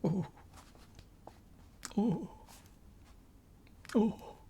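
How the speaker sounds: high sobbing oh; mild mock sobs. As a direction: faun wailing lightly. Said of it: "sobbed Mister Tumnus".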